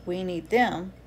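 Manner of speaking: gentle, lively picture-book read-aloud; reading to children. Acted. Author reading her bee book to kids, gentle and lively.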